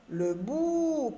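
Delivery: "bout" with a long held "ou", falling at the end; teacher's slow, sustained "bout"; elongated vowel. Shown as a demonstration. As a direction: stretch the "ou" of "bout". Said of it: "on allonge un petit peu le son de la voix".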